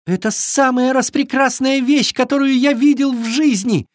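high, thrilled fawn voice; exclaiming young deer. A narrator's reading. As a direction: gush high-pitched as an excited fawn. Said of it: "воскликнул он".

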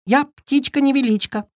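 high chirpy little bird; cartoon bird voice. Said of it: "чирикнула малышка". (щебечущий голосок птички)

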